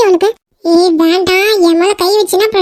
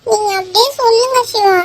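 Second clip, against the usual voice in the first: ordinary talk, nothing marked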